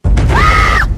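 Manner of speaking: anguished wail. Acted. Wailing over the fallen prince.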